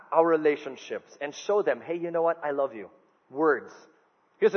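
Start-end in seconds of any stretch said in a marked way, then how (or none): 1.8-2.9 tender. Retold or imitated. Showing spouse love in words.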